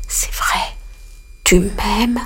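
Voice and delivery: soft unvoiced whisper; ant whispering. A narrator's reading. Ant enchanted, whispering back to the dove. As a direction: breathe it out secretly. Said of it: "la fourmi murmura".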